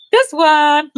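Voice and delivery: high squeaky cartoon voice; squeaky toy voice. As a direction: pipe it up like a toy.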